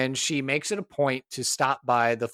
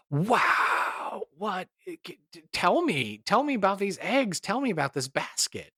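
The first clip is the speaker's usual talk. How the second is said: put-on higher, gently curious voice for a child; coaxing wonder; 6 semitones higher than usual. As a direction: ask the little boy kindly. Kneels to child's level.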